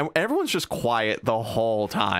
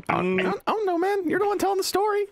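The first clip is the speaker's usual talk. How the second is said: much higher, mocking whine; teasing high voice; much faster than usual. Real, not acted. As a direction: squeak it back playfully at the storyteller. Friend teasing storyteller.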